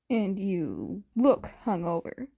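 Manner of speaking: usual speaking voice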